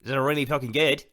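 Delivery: loud, hammed-up Australian; bad Australian accent. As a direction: put on a broad, unconvincing Australian voice. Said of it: "That's not an Australian accent".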